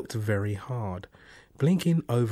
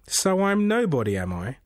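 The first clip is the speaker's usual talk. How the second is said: teasing unseen voice; 7 semitones higher, 3 dB louder than usual. Acted. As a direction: toy with him, playfully smug. The voice amused.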